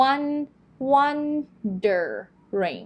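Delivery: "wondering" slowly in pieces, high WON then "der"; R in middle syllable. Shown as a demonstration. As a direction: split "wondering" up, sounding the R in "der". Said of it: "ต้องมีตัว R ด้วยนะตรงท้าย".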